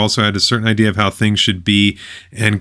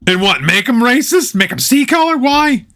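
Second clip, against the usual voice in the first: sarcastic questions climbing much higher; mocking disbelief, voice rising higher and higher; incredulous mockery; 12 semitones higher than usual. Real, not acted. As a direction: scoff at the idea, pitch shooting up.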